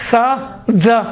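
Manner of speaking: سا and زا sounded out slowly; teacher sounding out سا and زا; letter demo. Shown as a demonstration. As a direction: demonstrate سا then زا clearly. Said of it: "دیکھیں، زے اور سین".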